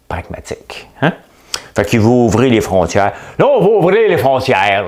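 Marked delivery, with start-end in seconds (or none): 3.4-4.9 mocking politician voice. Repeating Coderre quote.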